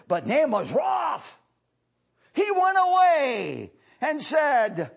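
loud, high, slow proclaiming; fervent Bible reading; preached scripture reading. Acted. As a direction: declaim the verse to the church. Reading scripture at full force.

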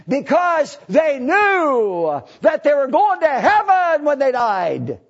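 a revival preacher in full cry; preaching at full force. Acted. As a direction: lift the whole room with it. Preacher builds to a climax.

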